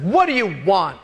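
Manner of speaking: acted exasperation at the door; angry, fed-up tone. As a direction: demand it like you're mad at being disturbed. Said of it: "But with that tone".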